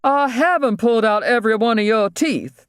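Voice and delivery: high-pitched protesting dentist; indignant objection. A narrator's reading. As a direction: object in a high, put-upon voice. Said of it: "the dentist objected with his hand over".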